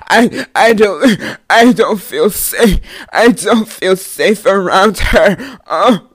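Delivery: hammed-up loud crying, words dragged out; mock bawling. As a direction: imitate a hysterical sobbing accuser, gulping between words. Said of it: "I'm talking about fucking crying".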